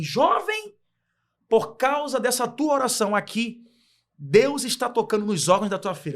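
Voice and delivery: high-pitched cry on the first word, then slow, loud declaration; imitated prophetic shout. Missionary laying hands on a crying father, imitated high shout. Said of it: "ela grita e falando assim".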